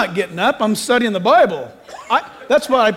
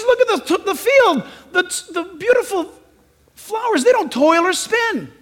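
awed wonder; 8 semitones higher than usual. Acted. Jesus quoted, awed.

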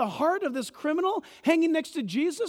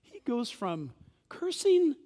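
normal talking voice

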